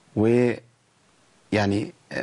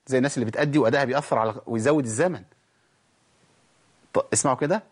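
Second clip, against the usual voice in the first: normal talking voice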